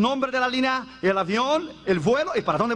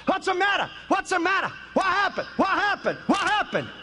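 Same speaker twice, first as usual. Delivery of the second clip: high, alarmed repeated questions; startled, anxious questioning; startled passenger; 6 semitones higher than usual. Retold or imitated. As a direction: ask in a panic what is going on. Frightened man wakes up.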